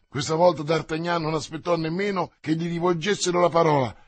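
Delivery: usual speaking voice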